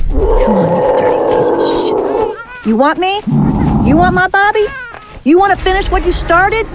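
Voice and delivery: hissed then rising taunts at the monster; furious taunt at the monster; taunting anger. Acted. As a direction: goad the monster, voice climbing. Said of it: "Steady, deputy".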